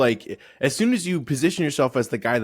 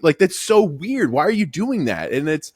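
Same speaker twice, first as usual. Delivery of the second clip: ordinary delivery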